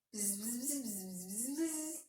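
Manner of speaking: rhythmic bee buzz, rising and falling; playful zigzagging bzz-bzz; buzzing bumblebee. Pretend. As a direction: buzz like a bee in their ear. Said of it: "come and buzz in your ear".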